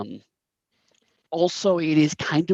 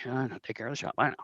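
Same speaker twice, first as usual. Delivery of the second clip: much softer, offhand acknowledgement; employee's reply; 5 dB quieter, much faster than usual. Acted. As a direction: answer the boss quietly, in an unaccented voice. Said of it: "And he's like".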